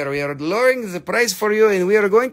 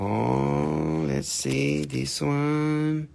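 slow drawn out; 6 semitones lower, 6 dB quieter, much slower than usual. Pretend.

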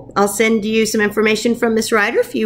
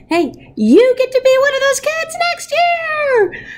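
much higher, bright call-out; excited announcement; 3 dB louder than usual. Real, not acted. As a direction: tell them the happy news with a burst of excitement. Teacher excitedly tells pupil news.

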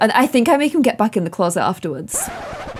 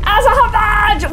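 higher mock shout of her old self; mimicking own shouting; 9 semitones higher than usual. Mocking her younger self's intro, a higher mock shout. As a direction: parrot her past self's overeager shout. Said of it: "Why am I just shouting?".

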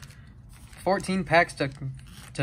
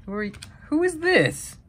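surprised; 13 semitones higher than usual. Real, not acted. Puzzled by unknown autograph.

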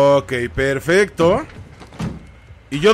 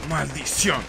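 cursing under breath; 2 semitones lower, 9 dB quieter, slower than usual. Real, not acted. Curses after a miss.

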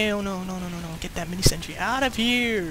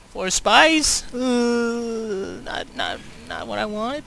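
ordinary delivery

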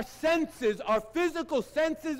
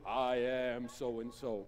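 mock pompous boast, slow and low; drawn-out boast, mocking the proud; mock self-important; 14 semitones lower, 9 dB quieter, much slower than usual. Mocking someone who boasts to doubting family, slow and low.

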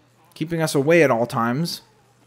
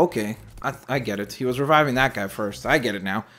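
normal talking voice

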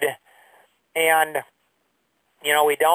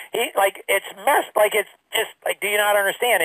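higher, exasperated, mildly raised retelling; 6 semitones higher than usual. Retold or imitated. Telling mentor Tom about the partner, exasperated raised voice. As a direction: vent the wasted years at him. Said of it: "I raised my voice".

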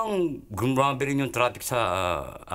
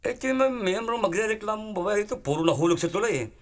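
high-pitched exasperated grievance; acted-out indignant complaint; angry chairman; 11 semitones higher than usual. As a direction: complain crossly, pitched well up. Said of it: "Galit na galit yung chairman".

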